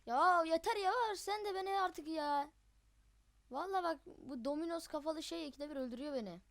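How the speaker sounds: high, loud exasperated complaint; fed-up outburst. Real, not acted. Player fed up with the enemy killing him, exasperated shout. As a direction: shout your frustration at the game. Killed again by same enemy.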